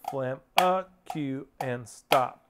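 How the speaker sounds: spaced-out syllables on the beat; measured drum-teacher count; slow rhythmic demo. Shown as a demonstration. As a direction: speak each stroke slowly in time. Demonstrating rhythm of flam cue.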